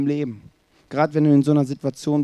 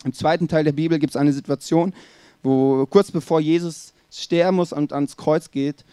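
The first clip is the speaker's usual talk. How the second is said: usual speaking voice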